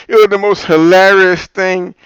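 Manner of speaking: loud, high, drawn-out amused exclaiming; hilarity. Real, not acted. Telling viewers about the crazy shouting match, hilarity. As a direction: stretch the words, thoroughly tickled by the chaos. Tickled retelling of a chaotic debate.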